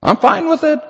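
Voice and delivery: Jesus begging the Father, rising to a near cry; crying out in prayer. Acted. Preacher voicing Jesus in the garden, pleading and rising. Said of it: "cries out to God the Father".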